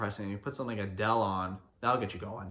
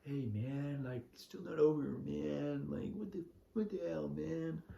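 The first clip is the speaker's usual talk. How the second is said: soft, feeble mock moping; mock mopey; 10 dB quieter, slower than usual. Heartbroken guy whining at a party, soft mock moping. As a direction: play someone still hung up on an ex, quietly.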